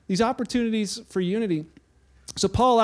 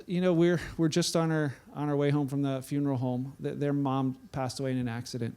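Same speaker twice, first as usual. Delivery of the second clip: lower, much softer, heavy news; quiet grieving father; 5 semitones lower, 5 dB quieter than usual. Retold or imitated. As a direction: let the loss sink in gently. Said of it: "he just simply says".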